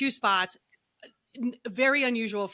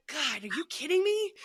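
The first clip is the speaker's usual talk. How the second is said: exasperated; 7 semitones higher than usual. Retold or imitated. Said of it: "I was like".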